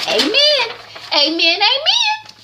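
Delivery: high, hammed-up churchy amen; church lady amen. [name] agreeing like church.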